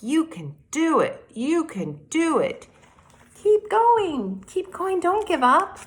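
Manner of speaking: high, loud chirpy cheering voices; birds cheering him on. A narrator's reading. Birds calling to the tortoise up the hill, high cheering. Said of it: "the birds called encouragement".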